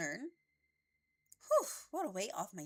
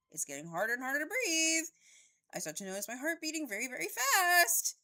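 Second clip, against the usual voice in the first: panicky; 10 semitones higher, 8 dB louder than usual. Acted. Panic attack narration.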